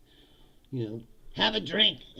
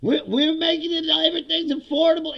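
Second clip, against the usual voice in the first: high, loud, laughing mock cheerfulness; mocking the lies; 13 semitones higher, 9 dB louder than usual. Vlogger mocking Mike Johnson's affordability claim in a high, loud voice. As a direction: parody a fake upbeat spin. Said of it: "repeating those lies".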